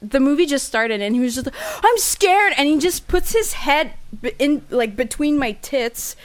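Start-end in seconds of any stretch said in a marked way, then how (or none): 1.8-2.5 high-pitched feigned fright; mock scared boy. Date feigning fright at a movie to snuggle, mock fear. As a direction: imitate a boy pretending to be frightened.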